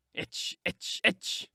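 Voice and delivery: hissed mocking chant; whispered taunt. A narrator's reading. Joseph whispering a mocking taunt at Linton. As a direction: whisper the jeer maliciously.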